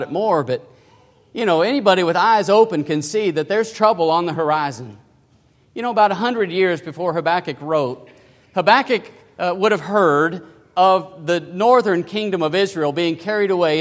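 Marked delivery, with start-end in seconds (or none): none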